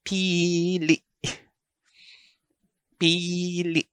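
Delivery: pili with long PI and an abrupt oomph on LI; oomph on the ending. Shown as a demonstration. Teacher asking learners to notice the ending, pili stopped abruptly. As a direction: draw out pili, then stop LI hard. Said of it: "Notice the oomph at the end".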